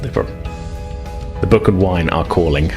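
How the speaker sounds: usual speaking voice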